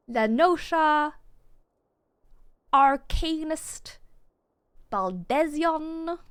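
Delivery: La Nosha, Arcanist, Baldesion, each drawn out slow and loud; exaggerated correct pronunciation. Shown as a demonstration. Streamer relearning game names, slow over-careful La Nosha, Arcanist, Baldesion. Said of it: "I've been pronouncing every single word".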